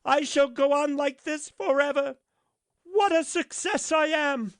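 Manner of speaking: high, loud, thrilled; delighted cry. A narrator's reading. Rocket in the air, high joyful cry. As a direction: savour the flight.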